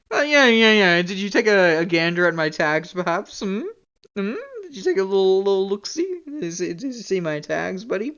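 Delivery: loud, mocking needling; sarcastic grilling. Real, not acted. Streamer grills viewer who missed the tags, loudly sarcastic. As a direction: needle the viewer with exaggerated questions.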